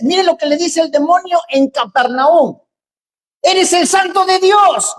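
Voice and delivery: loud, high fervent preaching; preaching at full force. Acted. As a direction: preach it hard to the congregation.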